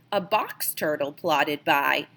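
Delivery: usual speaking voice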